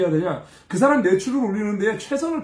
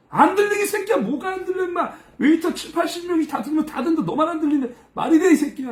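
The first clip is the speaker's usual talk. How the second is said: high-pitched, scornful scolding; acted furious tirade; 7 semitones higher than usual. Waiter berating the assistant over a missed call, high and angry. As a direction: imitate someone throwing a fit. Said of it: "이러면서 막 생 지랄을 하는 거예요".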